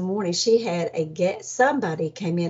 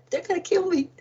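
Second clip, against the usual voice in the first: high fearful wail; 9 semitones higher, faster than usual. Retold or imitated. Fear of the treadmill.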